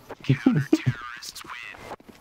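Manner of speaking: airy laugh under the breath; breathy laugh. Real, not acted. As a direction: let out a soft breathy laugh. Laughing after booing viewers.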